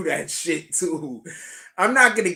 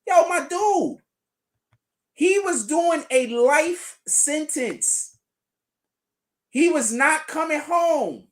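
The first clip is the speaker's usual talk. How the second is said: loud, slow, higher disbelief at chat; exasperated shout; 8 semitones higher, 3 dB louder, slower than usual. Real, not acted. Streamer yelling at chat over the life sentence, slow loud disbelief. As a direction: hammer each word, yelling at chat.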